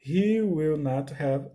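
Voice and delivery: slow, careful English model; slow corrected example. Shown as a demonstration. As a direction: say "I will not have" word by word. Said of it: "confundi tudo aqui".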